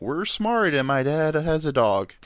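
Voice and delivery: high-pitched mock sitcom snob; Frasier. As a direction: put on a hammed-up, self-satisfied character voice. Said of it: "then Frasier's like".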